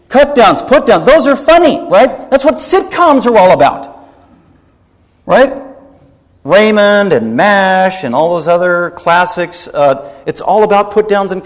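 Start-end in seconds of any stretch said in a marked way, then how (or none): 0.1-5.6 high, loud parody of worldly approval; mock enthusiasm. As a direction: sell it like a gushing fan, exaggerated.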